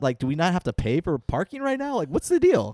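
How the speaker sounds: puzzled caller asking what's going on; mock bewildered; confused. Retold confused phone call.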